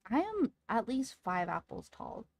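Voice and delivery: ordinary delivery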